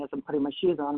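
ordinary delivery

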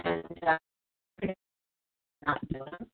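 breaking up on a bad connection; video call lag. Real, not acted. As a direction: voice cutting out and fading. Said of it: "Mara, we're losing you".

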